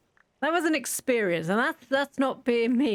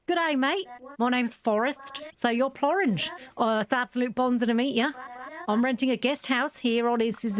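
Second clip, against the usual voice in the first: hammed-up Australian accent, higher; terrible Australian accent; 3 semitones higher than usual. Sim introducing himself to the player, in a hammed-up Australian accent. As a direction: play a cheerful Aussie renter, badly faked Australian. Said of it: "sorry for the terrible accent".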